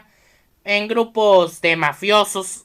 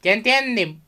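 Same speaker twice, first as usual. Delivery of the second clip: ordinary talk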